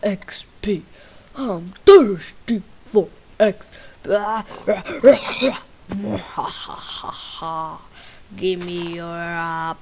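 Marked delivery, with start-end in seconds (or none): 1.3-3.7 loud, odd-voiced repeat. Louder retake of line. 3.8-8.3 loud villain cackle; evil laugh. Acted. Maniacal laugh.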